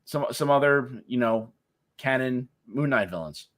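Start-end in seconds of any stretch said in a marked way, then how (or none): none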